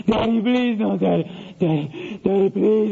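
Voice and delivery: imitated tearful little-boy pleading; whimpering child. Son promises to listen next time, tearfully pleading. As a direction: beg Daddy through mock sobs. Child begs father not to spank.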